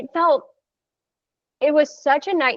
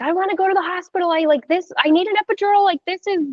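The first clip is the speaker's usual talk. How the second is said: higher, fast, panicky pleading; retold panic; 9 semitones higher, faster than usual. Labouring mother begging for the hospital, imitated panic. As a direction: rush the words like losing control. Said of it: "I'm panicking".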